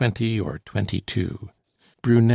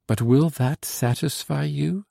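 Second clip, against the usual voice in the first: a pleading question pitched higher; beseeching; 6 semitones higher than usual. A narrator's reading. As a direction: gently beg him to say yes. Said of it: "looked at me beseechingly and said".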